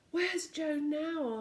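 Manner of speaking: slow, high, shaken question; shocked. Acted. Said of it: "quite pale with shock".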